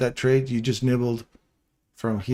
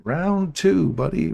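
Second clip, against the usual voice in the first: mock ring announcer; 6 semitones higher, slower than usual. Trader calls round two after the bell, announcer call.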